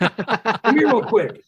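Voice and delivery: slow, high, loud summons; calling out. Retold or imitated. Ty calling Abby over across the room, slow loud call. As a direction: draw it out to wave her over.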